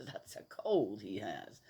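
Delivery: usual speaking voice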